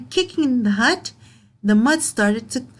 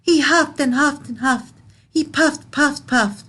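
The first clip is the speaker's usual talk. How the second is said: building effort; 5 semitones higher than usual. A narrator's reading. Reading the wolf blowing to children, pumped up and higher. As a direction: push each word like a breath.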